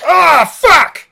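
high, loud mock outburst; hammed-up shout of dismay; frustrated yell. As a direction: curse it like a hero who's been tricked. Acted reaction to empty belt.

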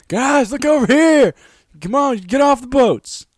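high, loud, drawn-out holler; calling out. Acted. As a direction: yell to soldiers far off on boats. Calling soldiers off ships, acted.

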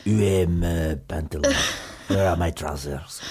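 low, throaty mock French accent; French accent from the throat, mouth nearly closed; lazy-tongued French accent. Shown as a demonstration. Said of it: "speak with a really lazy tongue".

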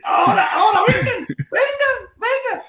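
high, drawn-out mock crowd call; mock call, pitched high. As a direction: shout them over like a vendor. Imitating someone calling out.

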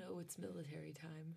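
whispered aside under breath. Real, not acted. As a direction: drop to a whisper, almost to yourself. Aside while reading fanfic.

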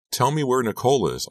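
pleading. Acted. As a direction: beg her to tell. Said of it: "I pleaded".

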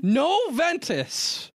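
wrestling promo. Acted. Promo hype continued.

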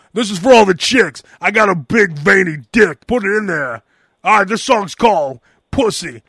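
lightly voiced metal frontman. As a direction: toss it out like a crude rocker to the crowd. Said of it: "[name] on stage again".

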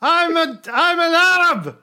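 loud, high-pitched shouted Hitler rant; Hitler impression. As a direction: rant at full volume like a ranting dictator. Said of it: "Don't know why I'm talking like Hitler".

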